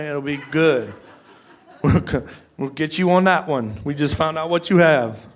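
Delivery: chuckling through the words; laughing. Real, not acted. Pastor joking with congregation about altar call, laughing. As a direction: laugh along at his own joke.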